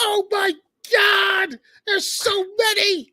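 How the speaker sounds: high, slow, shouted amazement; awestruck shout. Retold or imitated. Recalling the Death Star battle as a kid, a high, slow awed shout. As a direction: yell in wide-eyed awe at the screen. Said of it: "I was like".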